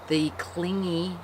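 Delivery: normal talking voice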